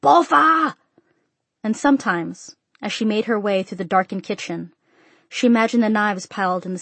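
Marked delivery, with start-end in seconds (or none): 0.0-0.8 loud, high, insistent summons; impatient father hollering for his daughter; calling out. A narrator's reading. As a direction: call the name louder, demanding she come. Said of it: "he called".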